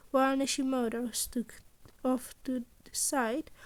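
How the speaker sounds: ordinary delivery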